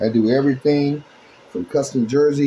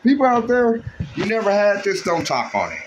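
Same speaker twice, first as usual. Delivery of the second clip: calling out; 9 semitones higher than usual. Real, not acted. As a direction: hype up the people watching. Calling out to viewers.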